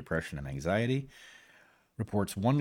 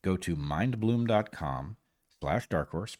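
normal talking voice

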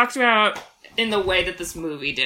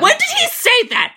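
mock outrage; 5 dB louder than usual. Real, not acted. Challenging co-host's claim.

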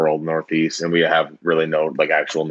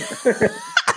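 high shrieking laugh. Real, not acted. Laughing at the Shirley Temple exchange.

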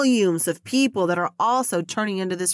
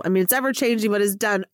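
ordinary delivery

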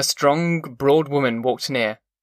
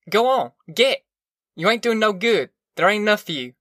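high-pitched, urgent dismissal; folksy voice chasing children away; shooing children off; 7 semitones higher than usual. A narrator's reading. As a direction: send them packing in a raised pitch. Tom shooing away hungry children.

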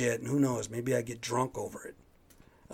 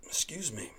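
whispered; 3 semitones higher, 5 dB quieter than usual. Real, not acted. Apologizing to listeners again, whispered. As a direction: Excuse me in a soft voiceless whisper.

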